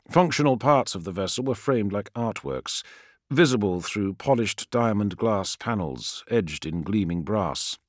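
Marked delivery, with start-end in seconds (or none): none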